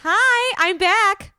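high, loud, bright hello; bursting back in with a big hi; excited return greeting. Real, not acted. Sister greeting the listeners on her return, high and loud.

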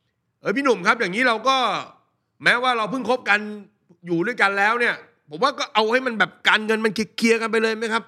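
put-on a young man politely asks the host about money; eager young listener. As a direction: pitch it way up like an earnest fan writing in. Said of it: "มีคนถามพี่เหมือนกันนะว่า".

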